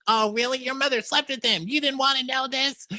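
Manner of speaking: camp it up as the gossiping housewife; mock Portia. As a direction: housewife dishing dirt on her rival's mom. Voicing Portia.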